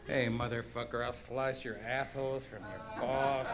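muttered mock threat.

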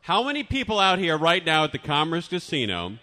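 MC building to a loud shout; crowd hype. Pretend. Host rousing casino crowd in the contest, building hype shout.

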